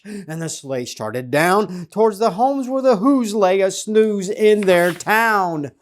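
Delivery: plain reading voice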